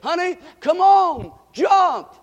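calling up loud and high into the dark; father calling out. Retold or imitated. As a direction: shout up to his little girl to jump. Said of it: "the daddy was saying".

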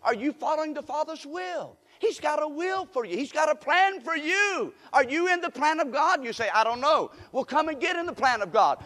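high insistent preacher's challenge; preaching, high and pressing. Acted. Preacher challenging the congregation to join God's plan, high and pressing. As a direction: push the question hard at the pews.

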